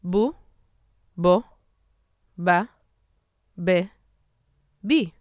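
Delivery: Bu bo ba be bi, each vowel clean and plain; vowel drill. Shown as a demonstration. Teacher drilling Hebrew vowels with b. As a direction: drill through the syllables one by one. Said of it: "Let's do it with b".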